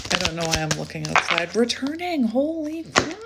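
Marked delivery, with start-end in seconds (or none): 1.6-3.2 higher, breathless amazement; amazed. Real, not acted. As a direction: gasp it out in disbelief. Card matches prediction.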